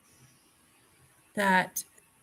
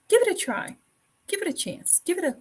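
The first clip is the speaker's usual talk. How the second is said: eager pleading; 9 semitones higher, faster than usual. Real, not acted. Pleading to try cultures.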